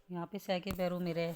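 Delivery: ordinary talk, nothing marked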